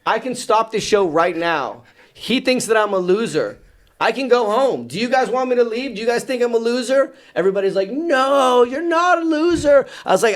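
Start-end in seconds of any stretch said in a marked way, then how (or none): 0.0-7.1 high-pitched indignant appeal; retold rant, pitched well up; snapped, indignant. Presenter appealing to the school crowd about a heckler, snapped. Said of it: "I just snapped for a second". 7.9-9.8 high, loud imitation of a crowd; chorus it like a whole audience; crowd's protest. School crowd reassuring the presenter, high mock protest.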